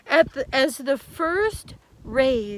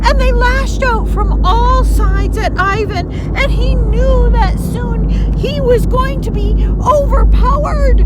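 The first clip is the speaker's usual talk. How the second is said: loud, high, urgent storytelling; intense battle narration; 7 semitones higher, 10 dB louder than usual. A narrator's reading. Storyteller urgently narrating the monsters attacking Ivan. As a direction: drive the danger hard.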